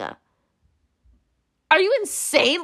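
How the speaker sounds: slow, high, incredulous; drawn-out disbelief. Real, not acted. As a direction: stretch out the disbelief. Exasperated rant.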